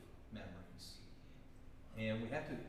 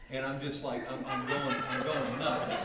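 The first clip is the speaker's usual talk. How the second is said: building louder as the laugh breaks through; laughing frazzled rant; 8 dB louder than usual. Real, not acted. Teacher tells the class about the missing podium, getting louder and laughing. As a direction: ramp up, laughing at your own panic.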